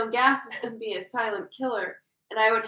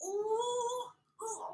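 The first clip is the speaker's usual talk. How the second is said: high, soft pained "ooh" imitation; mock wincing; 10 semitones higher, 12 dB quieter than usual. Imitating the prankster as brother walks in, wincing. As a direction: wince it out in a small high moan. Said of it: "like, wincing and just, like".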